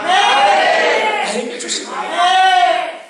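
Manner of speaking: very slow, soaring high cry; emphatic cry. Real, not acted. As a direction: stretch each word upward in awe. Preacher's emphatic climax.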